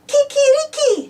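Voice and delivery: high crowing rooster call; Spanish rooster crow, shouted high; rooster yell. Shown as a demonstration. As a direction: yell it like a rooster to wake her. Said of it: "we have to yell".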